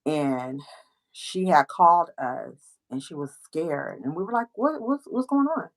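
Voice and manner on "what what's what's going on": high, startled what's going on; alarmed. Retold or imitated. Friends answer her scared call, alarmed. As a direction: jump in alarmed.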